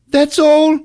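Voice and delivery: very high, slow, breathy-prim lady voice; prim English lady. Retold or imitated. Eve telling Hugh what she saw, hammed-up high prim lady. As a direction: play a flustered posh lady. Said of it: "He's like".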